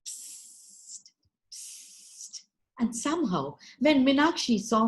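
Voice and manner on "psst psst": unvoiced sneaky psst hiss; lizard hiss. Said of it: "who was going psst".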